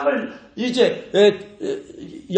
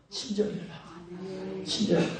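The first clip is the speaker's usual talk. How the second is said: gentle urging; 11 dB quieter than usual. Shown as a demonstration. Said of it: "repeats command softly".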